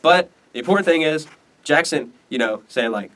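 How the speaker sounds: ordinary talk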